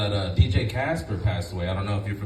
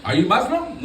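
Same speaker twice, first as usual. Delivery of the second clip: Lyft driver; 13 semitones higher, 3 dB louder, slower than usual. Retold or imitated. Lyft driver excitedly greeting him.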